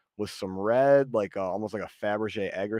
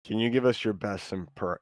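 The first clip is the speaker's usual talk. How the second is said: ordinary talk, nothing marked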